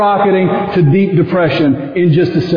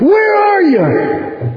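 crying out to God; 12 semitones higher, much slower than usual. Retold or imitated. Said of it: "he yelled at God".

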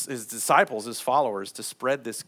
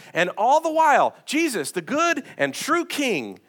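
impassioned preaching; 10 semitones higher, 6 dB louder than usual. Real, not acted. Preacher swelling to climax.

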